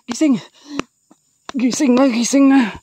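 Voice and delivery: loud, high pleading shout; urgent shout. Real, not acted. Begging the fainted woman to wake, loud urgent shout. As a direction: yell at them to wake up. Urging fallen character to wake.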